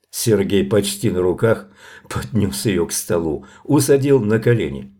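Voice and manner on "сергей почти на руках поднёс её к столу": plain reading voice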